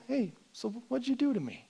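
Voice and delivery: high, soft, breezy asking; gentle mock question. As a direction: ask it lightly, almost casually. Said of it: "not like a gentle".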